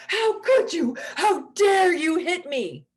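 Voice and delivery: shrill, loud wailing outrage; overreacting monster. Shown as a demonstration. Parent overreacting to slapping toddler, loud wailing high outrage. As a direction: wail it like a hurt child-monster. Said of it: "The monster goes".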